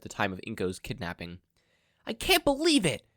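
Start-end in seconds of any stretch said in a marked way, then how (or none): 2.0-3.1 high, loud frustrated outburst; voiced boy shouting in frustration; frustrated yell. A narrator's reading. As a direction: yell it, pacing and fed up. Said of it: "Izuku yelled".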